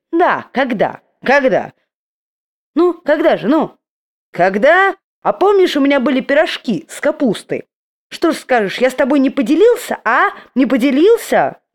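high, loud indignant challenge; heated quarrel. Acted. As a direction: needle him, indignant.

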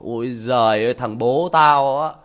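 put-on loud, slow scornful kid sneering at his dad; mocking son. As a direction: drag it out with contempt. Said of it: "nó biết suy nghĩ, nó bảo".

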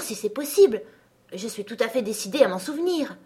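ordinary delivery, nothing marked

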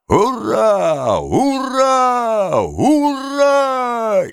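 long, high hurrahs; crowd cheering. A narrator's reading. Crowd cheers the orator's oath.

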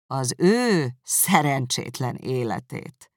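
slow, scornful echo of ő szerencsétlen életét; contemptuous. Acted. Darcy echoing Elizabeth's words back at her, slow and contemptuous. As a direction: drag out "Az ő szerencsétlen életét" with disdain. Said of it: "ismételte Darcy megvetően".

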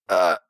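low, short gasp of shock; stunned gasp. A narrator's reading. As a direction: gasp as the blade goes in. Stabbed boy gasps.

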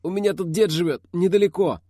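high, strained character voice; wounded man's voice. A narrator's reading. Wounded Aidar offers grandfather's house, high strained voice. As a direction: speak up urgently despite the wound.

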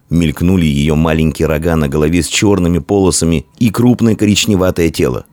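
plain reading voice

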